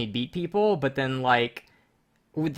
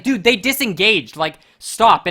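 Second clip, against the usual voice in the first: exasperated scolding; 5 semitones higher, 7 dB louder, slower than usual. Retold or imitated. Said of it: "And it's like".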